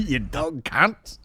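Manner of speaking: slow, high mock Australian drawl; mock Aussie. Quoting the Aussie lad to his cohost, slowly, with a laugh. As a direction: savour the insult like the Aussie. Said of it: "imitating the Aussie".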